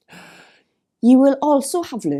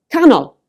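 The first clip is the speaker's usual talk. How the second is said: canol with "can" punched high and loud; stress on first syllable; 7 semitones higher, 8 dB louder than usual. Shown as a demonstration. Teacher giving a two-syllable example, canol stressed on "can". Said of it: "the emphasis is on the first syllable".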